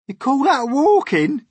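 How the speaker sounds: very high, loud scoff; jeering centipede. Acted. Centipede mocking earthworm's walking, jeering shout. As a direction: hurl the question high and loud.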